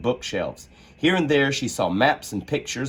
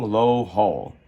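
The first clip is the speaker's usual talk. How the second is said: plain reading voice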